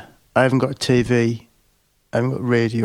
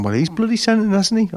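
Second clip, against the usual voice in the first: Harry indignant; 8 semitones higher than usual. Retold or imitated. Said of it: "He's going".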